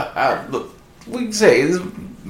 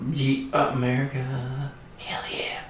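hushed, softer mock cheer; soft whispered patriotic cheer; whispered aside; 8 dB quieter, slower than usual. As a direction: breathe it under your voice like a secret.

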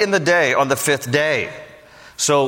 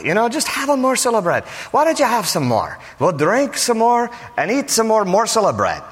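lightly put-on, higher-pitched Irish accent; 5 semitones higher than usual. Father-in-law urges more bread and drink, Irish accent. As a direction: press more food on a guest in mock Irish. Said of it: "I hear that in an Irish accent".

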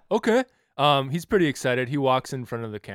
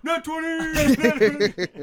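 much higher, louder, drawn-out cheer; excited shout; 14 semitones higher, 6 dB louder, much slower than usual. Real, not acted. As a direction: celebrate the roll. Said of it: "Oh, I forgot".

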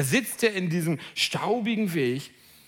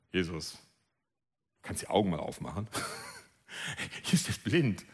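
much lower, softer, half-laughing; cheeky blind man; 8 dB quieter than usual. Pretend. Blind man jokingly answers Jesus, low and soft. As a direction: tease Jesus with a chuckle.

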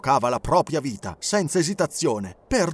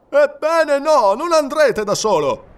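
high, loud, slow-starting cry; moved outburst; 12 semitones higher, 8 dB louder, slower than usual. Acted. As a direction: won over despite himself. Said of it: "esclamò il capitano, commosso suo malgrado". (il capitano commosso suo malgrado, grido alto e forte)

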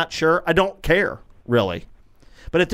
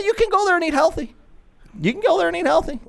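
sarcastic; 13 semitones higher than usual. Acted. Sarcastic mock of healthy eating.

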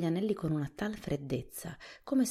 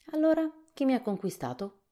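scarecrow king asking; 10 semitones higher, 7 dB louder than usual. A narrator's reading. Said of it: "gli chiese".

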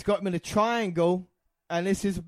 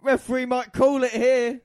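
raised, louder ringside call; excited commentary; 6 semitones higher, 6 dB louder than usual. Real, not acted. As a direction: call it with rising urgency. Live call of a submission hold.

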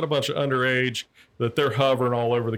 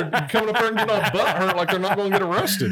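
mocking; 7 semitones higher than usual. Real, not acted. Mocking butthurt people.

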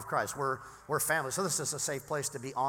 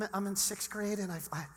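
put-on sixth grade girl voice; 3 semitones higher, 4 dB quieter than usual. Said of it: "let me put on my sixth grade girl voice".

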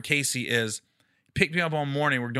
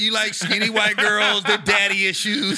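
teasing; 5 semitones higher, 5 dB louder than usual. Retold or imitated. Teasing the road guys.

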